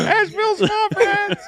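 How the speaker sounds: high excited shout; excited call. Pretend. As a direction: yell the team name with glee. Shouting team name.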